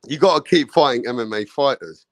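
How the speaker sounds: ordinary talk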